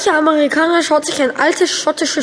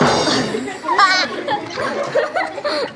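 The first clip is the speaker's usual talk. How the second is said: giggle; 6 semitones higher, 4 dB quieter than usual. Real, not acted. Laugh at punchline.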